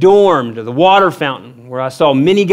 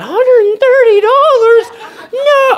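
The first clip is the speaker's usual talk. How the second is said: very high, loud, drawn-out shriek; hammed-up falsetto shock; shocked disbelief; 5 dB louder, slower than usual. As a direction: echo the price in squealing horror. Shocked echo of ticket price.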